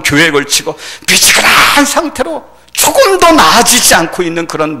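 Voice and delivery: high, loud sermon delivery; impassioned preaching. Acted. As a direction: press each phrase out forcefully. Preaching at full force. (열정적으로 외치는 설교)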